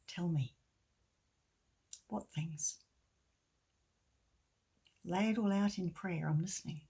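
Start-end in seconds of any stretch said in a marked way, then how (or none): 0.1-2.8 gentle invitation. Acted. Jesus gently invites prayer.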